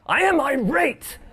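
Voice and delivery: mock irate declaration, high and slow. Presenter assigning his role to the crowd, hammed-up irate cry. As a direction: ham up the outrage. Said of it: "so my attitude".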